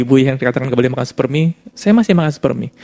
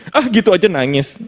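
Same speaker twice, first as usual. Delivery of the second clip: dismissive scoff; 6 semitones higher than usual. Retold or imitated. Husband dismissive of crying wife.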